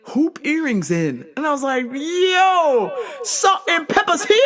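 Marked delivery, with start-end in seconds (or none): 1.9-4.4 high-pitched comic amazement; mock-astonished holler; startled exclamation. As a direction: announce it in hammed-up shock. Acting out startled reaction to earrings.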